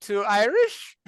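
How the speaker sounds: high, slow Irish lilt; a hammy try at Irish; Irish accent. Acted. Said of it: "I went East Indian with that".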